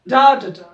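loud first beat of डा, डा, डा; डा, डा, डा with the first beat hit hard; stress rhythm. Shown as a demonstration. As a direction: tap out डा, डा, डा like a drum. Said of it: "पहिल्याला जोरात म्हणा".

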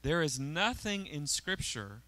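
usual speaking voice

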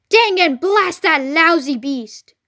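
loud, slow angry curse; angry cry. A narrator's reading. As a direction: curse the beast with drawn-out fury. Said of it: "cried Bogus".

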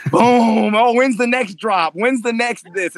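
high-pitched, eager rush after the boom; excited anticipation. Acted. Fighter reliving the thrill of the first exchange, excited. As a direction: act out craving the next thrill.

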